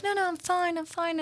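put-on a tired teen insisting she's fine; quiet daughter voice. Mother voices daughter Zoe.

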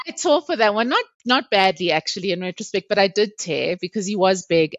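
ordinary talk, nothing marked

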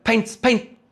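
drawn-out imitated instruction from a master; Mister Miyagi. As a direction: instruct the pupil slowly and high. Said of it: "and he's like".

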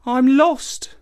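lost and downcast; sad. A narrator's reading. Lost youth answers the ape, sad and forlorn. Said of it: "replied Mvula Ana sadly".

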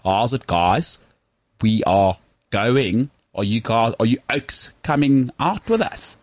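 exaggerated, dragged-out South African speech; slow South African accent. South African inviting friends out in Britain, slow broad accent. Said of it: "we speak really slowly as South Africans".

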